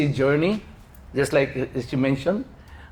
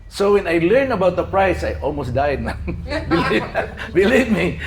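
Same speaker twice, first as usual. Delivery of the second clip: ordinary delivery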